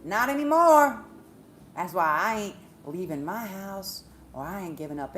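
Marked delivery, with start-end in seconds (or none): 0.0-1.0 loud, high emphatic refusal; real defiant outburst, raised pitch. Real, not acted. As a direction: belt it out as a firm refusal. Defiant turn to own life.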